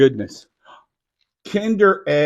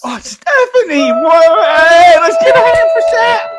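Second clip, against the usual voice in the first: much higher, loud excited cry; thrilled cheer; 13 semitones higher, 11 dB louder, slower than usual. Real, not acted. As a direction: celebrate the winner's name. Announcing giveaway winner.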